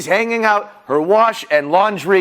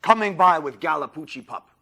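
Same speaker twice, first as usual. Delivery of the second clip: plain reading voice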